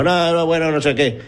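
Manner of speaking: lightly mocking, high-voiced "no, no"; dismissive retold brush-off. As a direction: imitate someone brushing off an objection. Retelling the builder brushing off objection.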